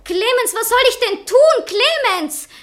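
high, loud calls after him, pleading; desperate calling out. Acted. Wife calls after departing Clemens, high and loud. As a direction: cry out his name as he walks away.

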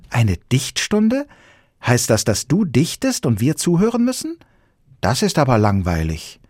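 a schoolgirl shaking her head; little girl disapproving. Acted. Girl voiced in story.

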